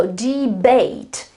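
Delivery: "debate" drawn out slowly, stress on BATE; stress on second syllable. Shown as a demonstration. Teacher shows where the accent falls, slow stressed "de-BATE". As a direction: lean on the last syllable of "debate". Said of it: "the accent is on the following".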